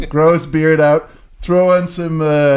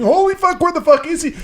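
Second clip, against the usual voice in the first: high startled cry of disbelief; retold shocked crowd. Punk crowd member losing the singer, high shocked cry. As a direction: mimic a stunned crowd, pitched way up. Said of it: "people going".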